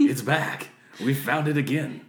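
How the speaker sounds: loud, high and excited. Real, not acted. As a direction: cheer it out. Excited joking exclamation.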